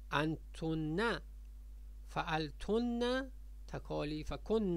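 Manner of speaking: انتن، فعلتن، کن said slowly with a long doubled n; slow geminated n in انتن، فعلتن، کن; doubled nun. Shown as a demonstration. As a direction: hold the n in انتن، فعلتن، کن, then add "a": "-tunna, -kunna". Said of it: "ببینید همشون نون مشدد داره".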